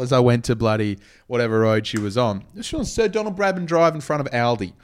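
ordinary talk, nothing marked

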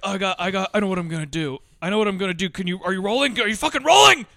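worked-up muttering building to a high shout; mock actor's rant, rising to a yell; imitated tirade. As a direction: start agitated, then explode on the last question. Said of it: "doing this whole tirade".

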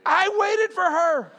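high, loud, drawn-out punchline; loud, slow self-mocking outburst; exasperated confession. Real, not acted. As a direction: cry it out slowly in disbelief at yourself. Exasperated punchline confessing his compliance.

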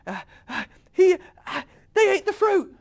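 hammed-up panicked report; freaking out. Jesus acted out reporting the eaten fruit, comic panic. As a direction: stammer up into a high, loud mock wail. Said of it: "I'm freaking out".

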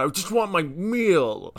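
high, slow whiny demand; retold demand. Teen correcting the quote of his drive-thru demand, whiny. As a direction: drag it out petulantly. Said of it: "I just said".

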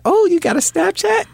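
a guy voicing a girl who wants his Snapchat; mock girl voice. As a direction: play the eager girl asking, way up high. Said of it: "she's like".

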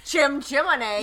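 loud, high, drawn-out British caricature; mock British accent. Joining the co-host's British bit, hammed-up British accent. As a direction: belt it out as cartoon British. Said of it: "we are offensive people right now".

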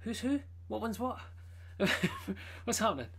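high, laughing confusion; high confused questions broken by a laugh; baffled reaction. Retold or imitated. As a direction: sound lost and amused at once. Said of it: "And I'm like".

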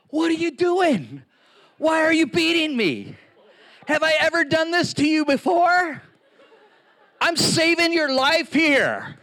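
a wronged donkey pleading with its rider; talking donkey. Retold or imitated. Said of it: "the donkey's mouth opened and he said".

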